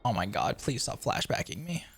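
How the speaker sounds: ordinary talk, nothing marked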